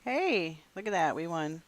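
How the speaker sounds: loud happy cheer; excited. Real, not acted. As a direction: call out the win with delight. Cheering a win in game.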